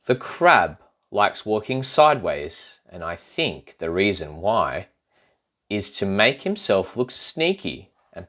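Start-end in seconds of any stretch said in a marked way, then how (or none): none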